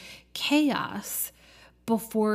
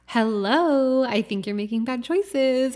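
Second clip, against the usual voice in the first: louder, more insistent hello; the universe speaking up; 3 semitones higher, 5 dB louder than usual. Pretend. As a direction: raise the volume a notch. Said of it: "it gets louder and louder".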